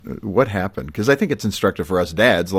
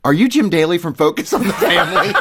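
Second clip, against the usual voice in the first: quoting her, louder and higher, through laughter; laughing; 12 semitones higher, 5 dB louder than usual. Real, not acted. Host retelling the mother recognizing him, laughing louder. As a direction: laugh while you tell it.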